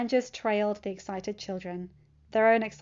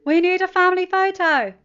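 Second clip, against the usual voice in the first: much higher, louder call; eager Natasha; 10 semitones higher, 13 dB louder than usual. A narrator's reading. Natasha calls the family for a photo, loud call. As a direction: call everyone together.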